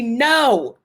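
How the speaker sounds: indignant. Real, not acted. Indignant answer to character's question.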